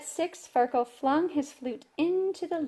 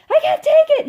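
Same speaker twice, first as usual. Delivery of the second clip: much higher, loud bellow; 14 dB louder than usual. Acted. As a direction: bellow it in a tantrum. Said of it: "he bellowed".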